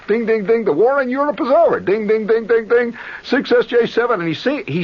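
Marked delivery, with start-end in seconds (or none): none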